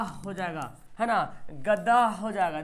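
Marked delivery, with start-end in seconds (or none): 1.5-2.1 slow गदा ending in a breathed h, "gadaah"; clearly sounded h. Shown as a demonstration. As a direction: stretch गदा into an audible final h. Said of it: "आवाज़ निकल रही है". (गदा को "गदाह" बोलें)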